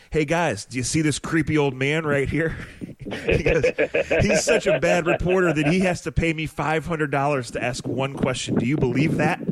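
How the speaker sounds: mock-serious tease for the children; a hint of a joking old pro; teasing coach to kids. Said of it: "he looks back at the kids and goes".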